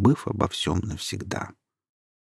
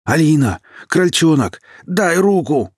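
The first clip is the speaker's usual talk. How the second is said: high, loud desperate calls; calling out; 8 semitones higher, 6 dB louder than usual. A narrator's reading. Kirill calling Alina to give him a hand, desperate. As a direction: shout to her across the sand. Sinking man calls for help.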